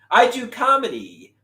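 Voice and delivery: ordinary talk, nothing marked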